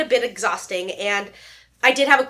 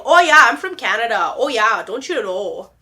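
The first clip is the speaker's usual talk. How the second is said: hammed-up Canadian accent; 5 dB louder than usual. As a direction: put on a cheesy Canadian accent. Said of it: "I'm so good at accents".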